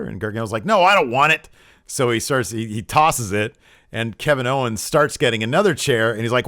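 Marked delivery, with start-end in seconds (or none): 0.6-1.5 higher, louder mock protest; imitating an annoyed brush-off; retold irritated refusal. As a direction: push the chair away with your voice.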